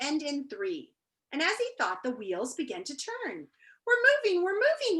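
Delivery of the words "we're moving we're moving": much higher, excited cry; happy shout. A narrator's reading. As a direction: cheer it out gleefully. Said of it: "he shouted happily".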